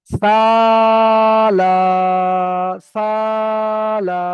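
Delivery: সালা with the first আ stretched very long and loud; drawn-out Quranic madd; long drawn vowel. Shown as a demonstration. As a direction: hold the আ of সালা for a full beat. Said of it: "তাহলে সিনটাকে যতটা টান দিয়েছি এখানেও ততটা আমাকে টান দিতে হবে".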